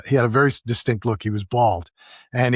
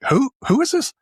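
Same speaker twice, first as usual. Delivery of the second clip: sharp, much higher startled question; startled who is this. Retold or imitated. Rich man woken by a cold call, startled high question. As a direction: caught off guard by a stranger calling.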